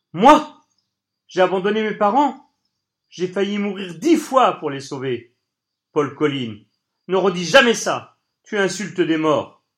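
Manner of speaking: loud, high, indignant shout; thundering outburst. Acted. Toby thundering back at Colline, outraged: loud shout. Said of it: "revint avec la force du tonnerre".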